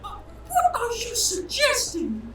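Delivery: very high, slow falsetto outrage; red-faced indignation. Acted. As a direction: seethe as an insulted wife in falsetto. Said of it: "gets super red in the face".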